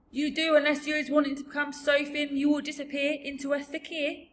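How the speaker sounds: loud, high-pitched giant warning; emphatic giant, rising at the end; insistent giant. Acted. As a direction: warn in a big, odd giant voice. BFG warns Sophie.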